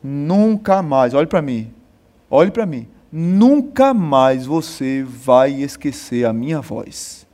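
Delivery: low, slow menacing captain's voice; a low growled threat from the army captain; stern captain. Retold or imitated. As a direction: warn him down low, dragging each word.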